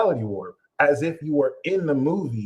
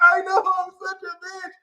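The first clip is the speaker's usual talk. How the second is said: mock meek agreement. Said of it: "partner agrees".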